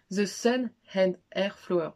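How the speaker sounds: slow, overdone French-English "The Sun and Air Flower"; bad English accent. Shown as a demonstration. Joking to viewers about her English, slow mock English. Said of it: "je suis très nulle en anglais".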